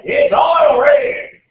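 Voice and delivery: high, loud, drawn-out yell; a slow, hammed-up high shout of the mnemonic; mock panic shout. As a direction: blurt it out like a student in a sudden panic. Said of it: "you're like".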